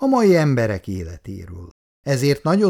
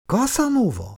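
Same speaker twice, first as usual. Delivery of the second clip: high eager exclamation of the name; light up and cry out the name; excited cry; 12 semitones higher than usual. A narrator's reading. Icu cries out eagerly to the writer, high and excited. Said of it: "kiáltott fel Icu lelkesen".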